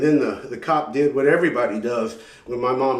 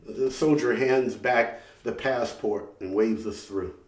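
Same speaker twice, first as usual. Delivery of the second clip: normal talking voice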